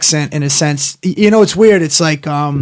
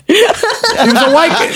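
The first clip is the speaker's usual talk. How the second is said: laughing through it; a laugh breaking into the line; 9 semitones higher than usual. Real, not acted. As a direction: laugh out the words. Laughing repeat of correction.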